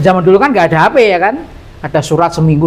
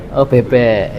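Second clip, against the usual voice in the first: usual speaking voice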